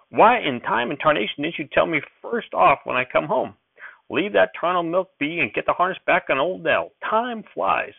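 put-on high-pitched urgent old-timer; old prospector. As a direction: hurry him up like an excited grandpa.

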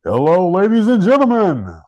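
eighties game show host; big cheesy TV host welcome. Pretend. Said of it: "game show host voiced on".

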